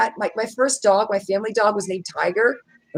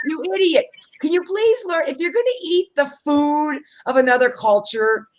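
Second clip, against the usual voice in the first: high-pitched exasperated rant; exasperated scolding; 8 semitones higher than usual. Retold or imitated. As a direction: plead with the clueless customer, voice raised high. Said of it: "get under my skin".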